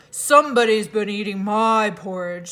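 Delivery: lightly voiced gruff papa bear; great huge bear's gruff voice. A narrator's reading. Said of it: "in his great rough, gruff voice".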